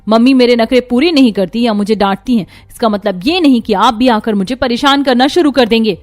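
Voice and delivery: loud, sharp tirade; angry rant. Acted. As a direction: throw her out of the room in anger. Daughter orders sister-in-law out.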